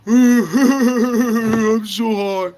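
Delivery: very slow, high, dragged-out stoner voice. Retold or imitated. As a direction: stretch it out like a cartoon stoner. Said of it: "they show the image of us being like".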